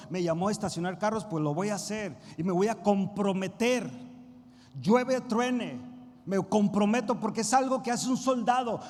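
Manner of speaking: loud, rising sermon cry; preaching at full force. Acted. Pastor urging the church to commit, loud preaching.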